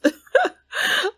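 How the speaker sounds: high squealing laugh. Real, not acted. Laughing at angry Sim.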